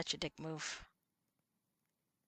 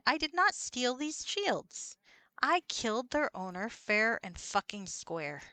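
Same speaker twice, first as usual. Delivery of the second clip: slow, emphatic indignation; indignant protest; 4 semitones higher, 4 dB louder, slower than usual. Real, not acted. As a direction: spell out your innocence, slowly and indignantly. Protesting being treated as a thief.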